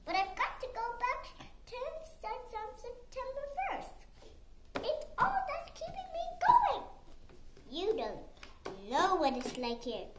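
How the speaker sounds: lightly voiced desperate boy; a hint of a pleading teenage boy; boy wizard voice. Harry protests to Dobby.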